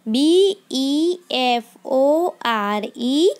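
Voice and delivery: each letter spaced out slowly; slow dictation of letters; slow spelling. Shown as a demonstration. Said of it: "teacher spells word for class".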